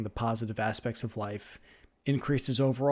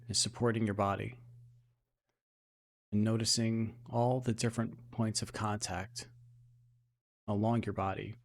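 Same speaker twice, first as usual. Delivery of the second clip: hushed, even meditation voice with long pauses; gently steer attention into the body, unhurried; guided meditation; 4 dB quieter than usual. Acted. Guide bringing listeners back to normal breathing, soft guided meditation.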